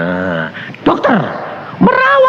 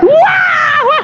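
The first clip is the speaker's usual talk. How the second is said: high-pitched exaggerated cry; mock wailing. Visitor cries twice as hard after scolding, mock wailing. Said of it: "Nangisnya dua kali lipat".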